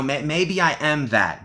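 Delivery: ordinary talk, nothing marked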